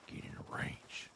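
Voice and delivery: whispered Get in the range. Pretend. Gamer coaxing animals into the range, whispering. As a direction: breathe it to the animals.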